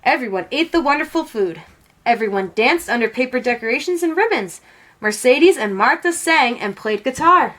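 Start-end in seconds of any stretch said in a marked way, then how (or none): none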